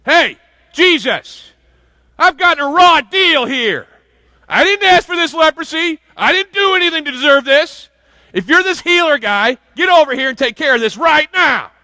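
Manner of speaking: high, loud, shouting demand; entitled demanding leper. Retold or imitated. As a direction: bark orders at the healer like you're owed it. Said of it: "he found him and he says".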